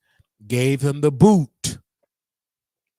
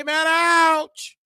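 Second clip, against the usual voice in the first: high squeaky mock ouch; lightly voiced whiny fan; mock pained viewer; 4 dB louder than usual. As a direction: play a wincing fan, pitched way up.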